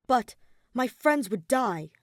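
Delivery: protesting. Acted. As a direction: push back hard for his friends. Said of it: "Shuichi protested".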